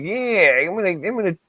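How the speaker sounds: put-on high, drawn-out Endicott upstate accent; imitating an upstate Endicott drawl, slow and high. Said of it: "these people from Endicott".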